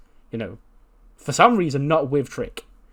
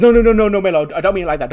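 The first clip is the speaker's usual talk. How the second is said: loud, higher rush of no's; panicked backpedal; 7 semitones higher, 10 dB louder, much faster than usual. Acted. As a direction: scramble to take it back, loudly. Trick hastily backpedals to Melo.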